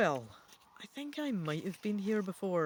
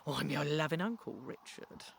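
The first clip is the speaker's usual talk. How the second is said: menacing growl; 4 semitones lower than usual. A narrator's reading. Ghost of the evil king growls at his nephew, low and menacing. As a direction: growl it at him, a hint of threat. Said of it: "the voice growls".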